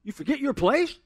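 very high, hammed-up indignant scolding; mock rude rebuke. Preacher parodies Jesus scolding his mom, exaggerated and rude. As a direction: play an affronted son telling mom off, loud at first. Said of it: "looking back at his mom and going".

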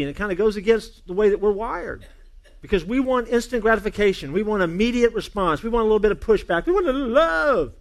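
loud, high, building preacher's cry; preaching at full force. Acted. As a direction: preach it out with rising fire.

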